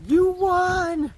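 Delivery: high-pitched mock holler; retold shout. Hiker tells a friend up the trail of a bet, mock shout. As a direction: imitate calling to someone far off, without full volume. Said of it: "Shouting over to whoever he was shouting to".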